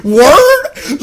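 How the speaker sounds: high, loud laughing "what? Word?"; laughing disbelief. Retold or imitated. Vlogger laughing at the planners' nerdy theorem talk, loud and incredulous. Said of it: "I'm like".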